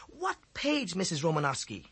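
high, bright sudden realization; a lightbulb goes on; enlightened voice. A narrator's reading. Boy suddenly understanding, asks the teacher eagerly. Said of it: "in an enlightened voice".

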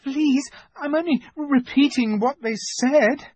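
put-on high, faltering boy's plea; panicked excuse to the queen; frightened stammer. A narrator's reading. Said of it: "stammered Edmund".

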